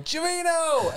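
high-pitched mock yell of a name; imitated yell. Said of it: "So she's yelling".

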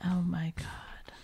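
slow exasperated whisper. Real, not acted. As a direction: breathe it out under your breath. Exasperated aside.

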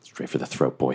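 low urging. Acted. Urging the wolf on.

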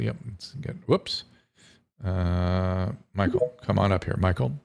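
ordinary talk, nothing marked

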